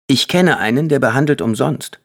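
put-on boy speaking up to his mother; boy's voice. A narrator's reading. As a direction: voice the son, bright and keen. Jay speaking, child voice.

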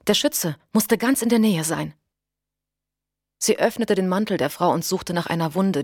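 usual speaking voice

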